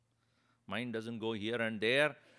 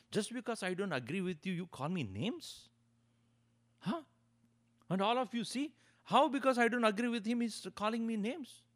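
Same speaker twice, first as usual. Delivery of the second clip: higher-pitched aggrieved protest; agitated complaint; 9 semitones higher than usual. Retold or imitated. Offended man complaining to the audience about the Swami, agitated. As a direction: appeal to the crowd, offended. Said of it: "He got so agitated, angry and upset".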